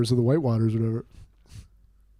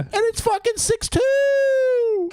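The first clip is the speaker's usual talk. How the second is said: excited; much slower than usual. Real, not acted. Excited at the home run.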